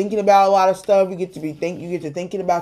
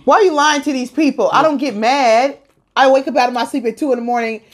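higher-pitched, affronted objection; indignant protest; 5 semitones higher than usual. Real, not acted. Wife telling husband off for lying to people, indignant. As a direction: defend yourself against a false charge.

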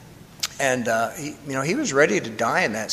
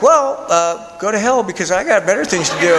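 mock retort; 4 semitones higher than usual. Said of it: "I was just gonna be like".

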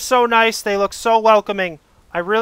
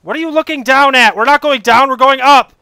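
loud, exasperated yelling at the screen; frustrated yelling; 3 semitones higher, 7 dB louder than usual. Real, not acted. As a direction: yell at the game in frustration. Raging at game character.

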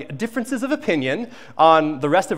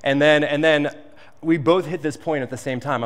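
ordinary talk, nothing marked

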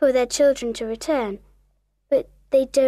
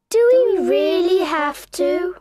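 put-on high, pleading children's question; John and Michael; 5 semitones higher, 4 dB louder than usual. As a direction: ask it as reluctant youngsters, pitched up. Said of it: "ask John and Michael".

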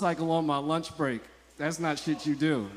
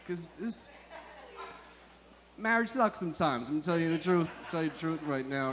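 ordinary talk, nothing marked

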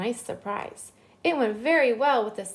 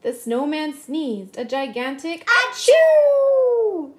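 big sneeze; 4 semitones higher, 7 dB louder than usual. A narrator's reading. Sneeze in read-aloud.

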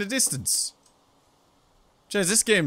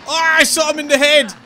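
excited shout; 7 semitones higher, 9 dB louder than usual. Real, not acted. Triumphant shot in horror game.

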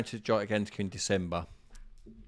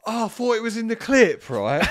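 squeaky innocent excuse, loud and high; high mock voice; 13 dB louder than usual. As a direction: play dumb in a shrill high voice. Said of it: "Matey's then said".

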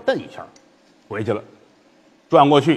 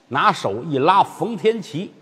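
ordinary delivery

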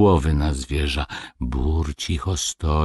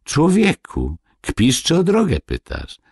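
much higher, affronted question; indignant outburst; 10 semitones higher than usual. Acted. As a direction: throw it at him in disbelief. Dwarves indignant at peasant.

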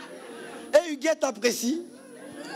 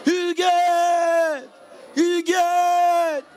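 calling the bride; 7 semitones higher, much slower than usual. Shown as a demonstration. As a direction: stretch the name out to build the excitement. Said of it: "quand je dis Huguette, ça crie normalement". (le pasteur annonce la mariée, appel lent et fort)

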